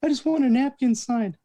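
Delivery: whiny woman. Retold or imitated. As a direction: plead like an indignant fan. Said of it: "she goes".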